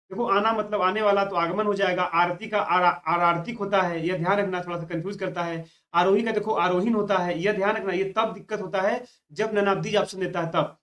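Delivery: ordinary talk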